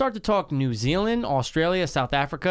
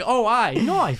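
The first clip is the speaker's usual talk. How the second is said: mock Australian; 9 semitones higher, 3 dB louder, much slower than usual. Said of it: "It's like".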